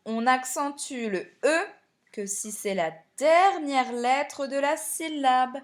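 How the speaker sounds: usual speaking voice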